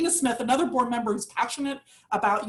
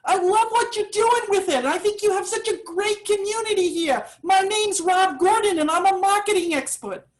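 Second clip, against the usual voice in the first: higher, bad New York accent; a hammy New York accent impression; Rob's New York accent; 7 semitones higher than usual. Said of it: "apologies to Rob for the bad New York accent".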